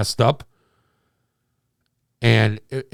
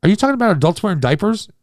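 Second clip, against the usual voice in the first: incredulous question; 8 semitones higher, 4 dB louder, faster than usual. Retold or imitated.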